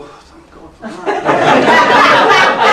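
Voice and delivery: high, loud breathy sigh of relief through laughter; mock relieved crowd. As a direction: play a whole crowd groaning thank God, laughing. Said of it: "eleven thousand people go".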